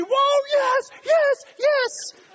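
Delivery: very high, whooping cheers; cheering fan. Acted. Concertgoer on their feet at the show, high ecstatic cheering. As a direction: cheer like the band just hit the stage.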